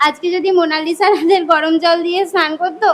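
tearful, sobbing explanation; weepy, melodramatic account; crying. Acted. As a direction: explain it all through sobs. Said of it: "মা এরকম কান্না করছে কেন?".

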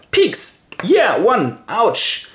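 squeaked stab, then high triumph and yelp; mock duel hit. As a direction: act out both fencers, high-pitched. Acted duel, scoring a hit.

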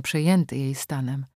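ordinary delivery, nothing marked

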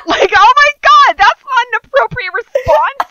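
high, loud cry of disbelief; incredulous, high-pitched protest; laughing outrage. Real, not acted. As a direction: holler it through amusement, appalled. Laughing outrage at the character's response.

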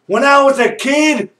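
usual speaking voice

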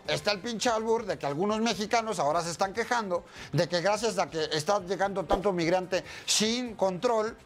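usual speaking voice

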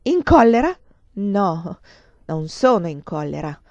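erupt, then rein it in with sarcasm; frenzied anger. A narrator's reading. Lover leaping up like a madman, frenzied anger. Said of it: "urlò lui, balzando in piedi come un forsennato".

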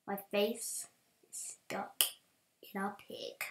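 hushed, unvoiced plea; whisper. Trapped in a pig, whispering to the viewers. Trapped in-game, mock despair.

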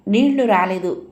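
ordinary delivery

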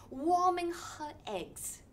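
plain reading voice

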